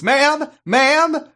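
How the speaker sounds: high, loud repeated call; shouting reporter. Retold or imitated. Reporter calling to Harriet at a press conference, loud and high. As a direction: clamour for attention from the press pack.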